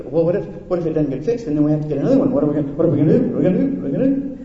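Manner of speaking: hammed-up worry, repeating the question; mock panic. Pastor acting out his daughter's money panic, mock fretting.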